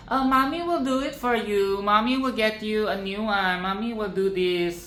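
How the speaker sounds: high sing-song mock of a lying mom; mocking mommy. Mocking the mom who promised to fix the toy, high sweet voice. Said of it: "So fucking liar".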